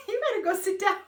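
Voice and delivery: high, quick mock scolding; mock bossy voice. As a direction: play a fussy nurse ordering her off her feet. Mock voice telling herself to rest.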